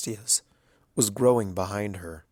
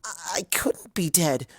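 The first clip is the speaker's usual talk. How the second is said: higher, stammering scared whisper; frightened whisper; 7 semitones higher than usual. A narrator's reading. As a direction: whisper it like you dread the answer.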